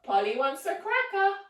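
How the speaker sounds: high squawky parrot squeak. Acted. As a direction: play a chattering parrot for the kids. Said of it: "we're gonna say".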